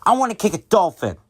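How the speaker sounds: put-on high, quick child's voice; high-pitched kid demand; bratty little kid. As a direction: play a demanding six-year-old. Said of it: "there was this kid".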